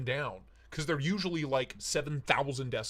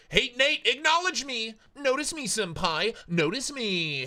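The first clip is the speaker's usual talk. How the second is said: mock pleading; 8 semitones higher than usual. Mock begging a viewer for attention.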